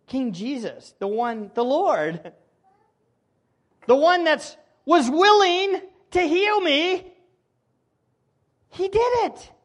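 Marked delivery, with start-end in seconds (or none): 3.9-9.5 excited leper. Retold or imitated. Leper voiced.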